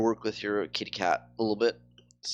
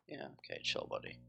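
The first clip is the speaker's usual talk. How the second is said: a soft, breathy aside telling him to chill; a quiet chill, buddy; muttered brush-off; 10 semitones lower, 15 dB quieter than usual. Real, not acted. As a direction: dismiss him under your breath. Brushing off a chatty NPC.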